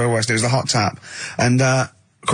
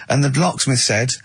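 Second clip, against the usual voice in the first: ordinary talk, nothing marked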